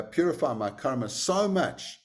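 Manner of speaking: ordinary talk, nothing marked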